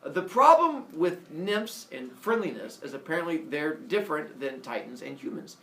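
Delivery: ordinary delivery, nothing marked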